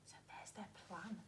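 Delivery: soft whisper. Shown as a demonstration. Teacher whispering the robbers' plan to the children. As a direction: whisper it like a secret. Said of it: "whispering explanation to children".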